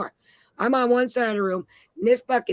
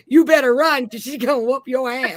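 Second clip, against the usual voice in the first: louder call, hammed up like a friend hollering a warning; mock warning yell; 3 semitones higher, 6 dB louder than usual. Friend hollering a warning to the ex, mock yell. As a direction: yell it out as a half-joking heads-up. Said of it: "all I heard was him go".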